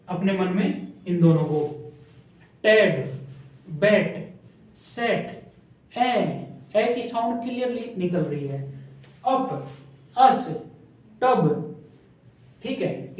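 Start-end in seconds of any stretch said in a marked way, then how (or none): 2.6-6.1 टेड, बैट, सेट said with a clear short e, सेट almost breathed out; pronunciation demo. Shown as a demonstration. Teacher contrasting vowel words for the class, slow and careful. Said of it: "आप अभी बोल बोल के देखिए". 9.3-11.6 अप, अस, टब punched with a short a; pronunciation demo. Shown as a demonstration. Teacher contrasting short vowels for students, punchy. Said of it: "इसमें कैसे निकल रही है?".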